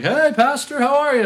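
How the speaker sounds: very high, loud mock greeting; overeager greeter. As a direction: gush at the pastor over the top.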